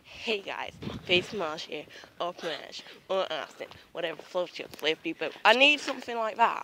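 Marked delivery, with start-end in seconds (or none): 0.0-5.3 YouTuber intro voice. Pretend.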